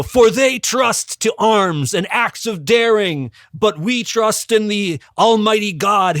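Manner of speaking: booming pre-battle movie speech, pitched high; rally the troops with a heroic oration; rousing military leader. Acted. Host acts out Judah's rallying speech to his army, grand and stirring. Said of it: "moment of truth speeches that military leaders always deliver".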